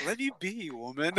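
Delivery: high-pitched mock complaint to a girlfriend; mock-pleading retort. Jokey retort to girlfriend, amid laughter.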